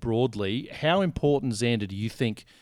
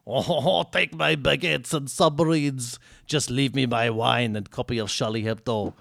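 a bad, hammed-up French accent, pitched up and slowed; terrible French accent; 3 semitones higher than usual. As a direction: ham up a clumsy French accent with a laugh in it. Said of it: "That's a terrible accent".

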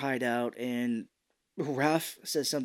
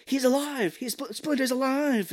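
much higher, thrilled exclamation; excited cry; 12 semitones higher, 3 dB louder than usual. Retold or imitated. Leo bursting in to announce Splinter is alive, excited. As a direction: announce the news in a rush of joy. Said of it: "he's like".

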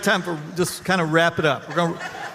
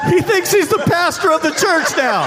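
high, loud, laughing teasing call-out; real laughter while ribbing a friend; laughing tease; 12 semitones higher, 8 dB louder than usual. Real, not acted. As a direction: crack up while ribbing him. Teasing the man on stage.

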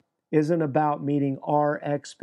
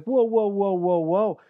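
startled protest; 4 semitones higher than usual. Retold or imitated. Peter objecting to Jesus.

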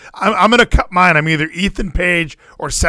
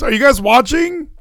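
usual speaking voice

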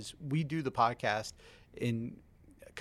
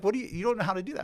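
higher and quick scolding wife; husband imitates his wife, higher; mock wife; 8 semitones higher, 5 dB louder, faster than usual. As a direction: rattle off her objection. Voicing wife's scolding.

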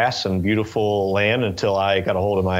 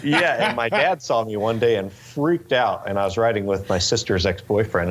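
normal talking voice

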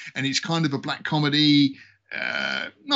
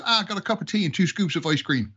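higher-pitched put-on Irish brogue; proud, clueless Irishman delivering the punchline; Irish accent; 5 semitones higher than usual. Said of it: "the Irish was the thick guy".

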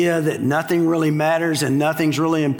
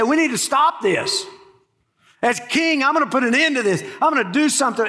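higher-pitched imagined royal resolve; mock resolve; 6 semitones higher, faster than usual. Preacher voicing what the king should have said, mock resolve. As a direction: act out a king taking charge, pitched up. Acting out the king's missing response.

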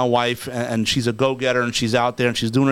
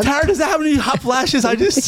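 excited; 11 semitones higher than usual. Real, not acted. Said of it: "hot flash plea".

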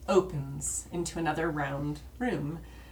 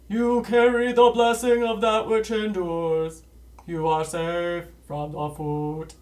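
giant alien booming voice; 10 dB louder than usual. Acted. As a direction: boom it out like a god in the chamber. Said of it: "a booming voice echoes through the chamber".

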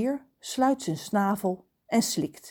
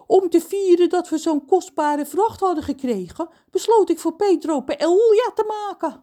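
higher voice for the proud cook; cook John; 10 semitones higher, 5 dB louder than usual. Acted. Cook John tells the children about his paella.

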